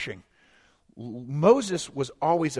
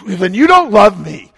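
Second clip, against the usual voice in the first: a louder, slow whiny complaint; whiny sulk; 10 dB louder than usual. Retold or imitated. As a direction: pout it like a hurt child. Mocking a sulking believer.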